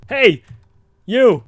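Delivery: calling out. Acted. Calling out to someone above.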